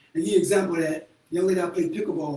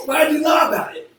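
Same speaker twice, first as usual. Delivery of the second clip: mock confrontation; 8 semitones higher, 8 dB louder than usual. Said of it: "I'm like".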